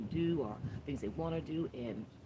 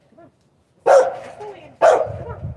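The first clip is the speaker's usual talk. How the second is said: loud, high call to the dog; 22 dB louder than usual. Real, not acted. Owner calling her dog Papa to come inside, loud and high. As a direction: call him in from across the yard.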